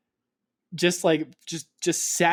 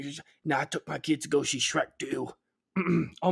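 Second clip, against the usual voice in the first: normal talking voice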